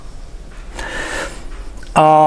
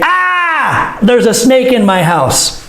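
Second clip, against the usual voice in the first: high startled cry, then the news; alarmed shout; 5 semitones higher, slower than usual. Shown as a demonstration. Teacher acting real panic at a snake in the house, shouting. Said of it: "I would probably say".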